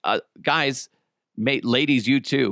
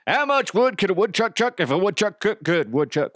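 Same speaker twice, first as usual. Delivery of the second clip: silly put-on voice; 7 semitones higher than usual. Comedic character voice.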